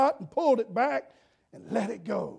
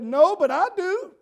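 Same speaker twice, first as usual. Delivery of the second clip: put-on eager little girl; 8 semitones higher, 7 dB louder than usual. Sister voiced in story.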